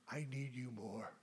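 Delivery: hushed breathless whisper; whispered prayer. Acted. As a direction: drop to a whisper of longing. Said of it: "whispered prayer".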